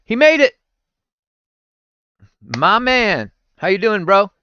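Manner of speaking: high, loud happy welcome; real delight at a friend arriving; excited greeting. Real, not acted. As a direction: cheer him into the room. Greeting a viewer who joined.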